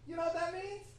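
ordinary delivery

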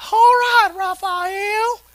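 high, loud Kentucky drawl on the greeting; a Kentucky farm boy's high twang; high-pitched southern drawl. Retold or imitated. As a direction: imitate a squeaky Southern buddy calling your name. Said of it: "he had a high-pitched southern drawl".

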